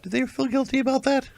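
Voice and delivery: high squeaky outrage; indignant squeak. Real, not acted. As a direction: squeak it out in mock disbelief. Mock indignant question.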